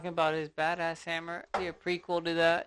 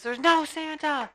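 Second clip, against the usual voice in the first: screaming; 9 semitones higher, 5 dB louder than usual. Retold or imitated. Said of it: "screams that he says".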